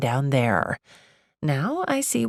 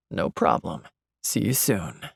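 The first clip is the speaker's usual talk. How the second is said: low male caller, easygoing; Josh; 8 semitones lower than usual. A narrator's reading. Josh agreeing to meet Gemma, low voice. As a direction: drop much lower for his brief sign-off.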